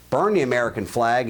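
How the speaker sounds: ordinary talk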